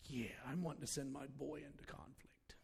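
whispered. Retold or imitated. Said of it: "dad's changed mind".

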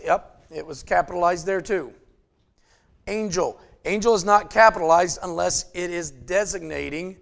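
usual speaking voice

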